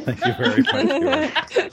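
giddy laughing thank-you; gushing thanks. Real, not acted. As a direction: squeal the thanks out in delight.

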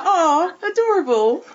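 high, gushing over something cute; delighted coo. Retold or imitated. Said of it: "I was like".

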